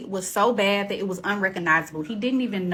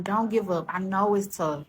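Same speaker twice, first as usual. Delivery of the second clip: usual speaking voice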